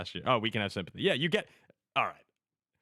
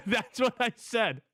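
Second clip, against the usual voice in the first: shocked laugh; 11 semitones higher, 4 dB louder, slower than usual. Retold or imitated. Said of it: "I went".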